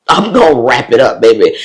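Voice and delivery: loud, playful declaration; emphatic boast. Retold or imitated. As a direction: belt it out with gleeful sass. Repeats her resolve, louder, laughing.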